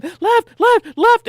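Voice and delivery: high, loud panicked shouts; yelled directions. Retold or imitated. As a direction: bark the turns in a panic. Kid yelling directions at blind driver.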